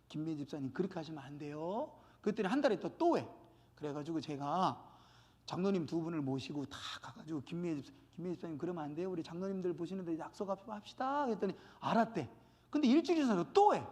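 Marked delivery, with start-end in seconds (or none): 0.1-1.9 soft, low private reproof; gentle admonition. Acted. Acting gentle first admonition of member. 7.4-11.3 hushed, low-pitched reproof; gentle admonition. Acted. Acting second admonition with elders.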